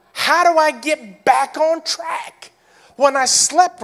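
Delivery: loud, rising preacher's cry; full-force preaching. Acted. Pastor to congregation, full-force preaching. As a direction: preach it hard, pleading with the church.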